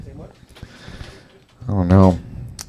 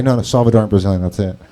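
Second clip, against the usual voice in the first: ordinary talk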